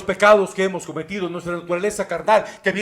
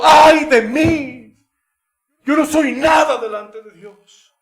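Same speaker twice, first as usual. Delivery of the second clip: loud, slow cry of woe fading down; shouted lament; 7 semitones higher, 6 dB louder, slower than usual. Acted. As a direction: cry out, then drop into confession. Isaiah's cry repeated.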